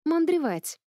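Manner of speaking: sudden high delighted cry of discovery; an old man jumps at a visitor, high and bright; surprised exclamation. Acted. Old geographer greeting the little prince, surprised high cry.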